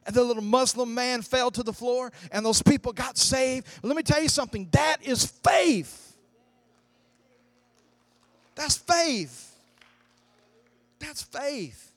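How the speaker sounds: high, loud preaching climax; full-force preaching. Acted. Pastor driving home faith to the congregation, fervent. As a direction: preach it to the rafters.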